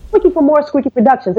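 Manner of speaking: as if they should know it; confident name drop. Retold or imitated. Repeating her company name to a receptionist, confidently. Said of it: "that was the inflection in my voice".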